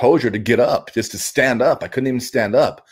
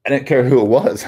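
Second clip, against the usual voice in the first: usual speaking voice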